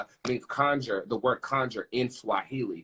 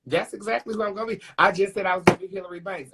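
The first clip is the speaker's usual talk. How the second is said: higher and fast, thrilled; excited; 8 semitones higher, faster than usual. Real, not acted. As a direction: rush it out, thrilled the card agrees. Excited at tarot match.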